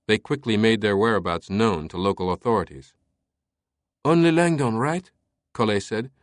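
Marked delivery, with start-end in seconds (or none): 4.0-5.1 put-on high, louder French-accented query; concerned lieutenant. A narrator's reading. Lieutenant questioning whom the captain will flag, concerned. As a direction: check anxiously in a raised voice.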